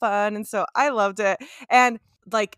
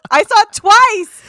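laughing excitedly; 12 semitones higher, 11 dB louder, slower than usual. Real, not acted. Excited about concert film.

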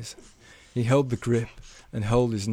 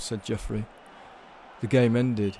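ordinary delivery

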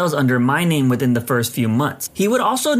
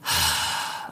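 long, low, soft deep sigh; 10 semitones lower, 8 dB quieter than usual. Retold or imitated. Coworker sighing deeply on hearing about the customer. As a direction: sigh heavily, worn out. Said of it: "she let out a deep sigh".

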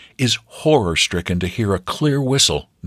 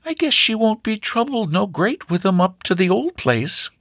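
put-on high, thin old farm grandmother; high-pitched old woman. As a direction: squeak it out like a country granny. Grandmother figure speaks.

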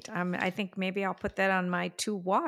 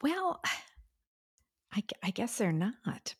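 whispering; 3 semitones higher, 7 dB quieter than usual. Real, not acted. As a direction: answer in a hushed whisper. Whispered reply to joke.

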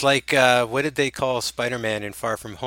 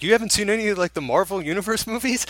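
mock disbelief; 9 semitones higher, faster than usual. Retelling a character's disbelief.

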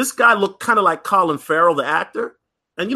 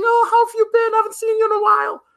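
put-on much higher, fast girly greeting; gushing club girls; faster than usual. Girls at the club greeting the local celebrity, in a high, fast, gushing voice. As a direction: squeal hello like excited club girls. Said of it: "girls coming up to this guy saying".

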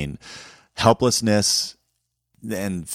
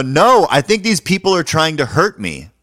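high, loud re-enacted plea; shouting for help; 10 semitones higher, 9 dB louder than usual. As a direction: yell it across the street to a would-be rescuer. Calling for help across the street.

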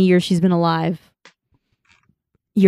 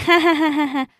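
high imitated ha-ha laugh; mock laughter; 8 semitones higher than usual. Factory workers laugh at a child's warning, mock laughter.

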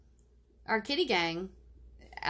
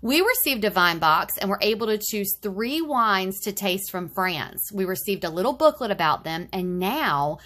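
bright, loud retro ad read; chipper 1950s ad; 5 dB louder than usual. Acted. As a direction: sell it like a vintage commercial.

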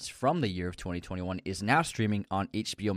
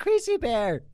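high, loud joking yell; excited shout; 13 semitones higher, 4 dB louder, slower than usual. Real, not acted. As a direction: call it out with glee.

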